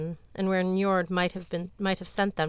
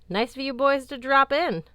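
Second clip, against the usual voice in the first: higher, louder, put-on cheer; forced cheerfulness; 8 semitones higher, 7 dB louder than usual. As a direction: cover the sadness with brightness. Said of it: "She forced some cheerfulness".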